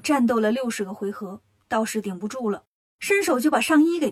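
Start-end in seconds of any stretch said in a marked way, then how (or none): none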